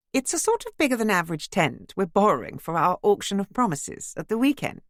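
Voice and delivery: cheerful well-to-do lady voice; bright posh neighbour. Acted. Imogen's voice.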